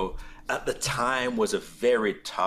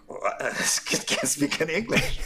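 laughing through a quote; 4 semitones higher than usual. Retold or imitated. Counselor baffled, teller laughs.

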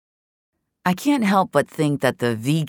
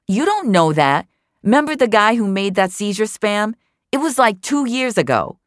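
higher, louder, insistent gossip; Tara, insistent; 10 semitones higher, 4 dB louder than usual. A narrator's reading. Tara argues with Jamie in chat, higher insistent voice. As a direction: dig in and insist she's right.

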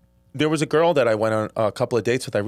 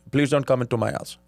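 put-on stern Indian dad accent, a bit softer; firm, polite refusal in an Indian accent; imitated Indian accent; 2 semitones lower, 3 dB quieter than usual. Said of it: "By the way, great Indian accent".

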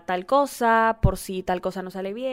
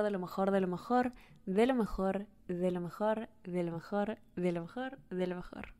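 softer and softer until barely heard; trailing off into a murmur; fading repetition; 9 dB quieter than usual. Real, not acted. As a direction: let each repeat fade away. Repeating blessing, trailing away.

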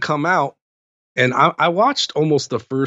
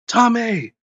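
calling out a name; 11 semitones higher than usual. Retold or imitated. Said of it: "all of a sudden I hear".

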